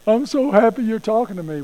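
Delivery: eager pet thrilled by attention; happy dog. Acted. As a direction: gush like an adoring, tail-wagging dog. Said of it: "the dog will just wag his tail like".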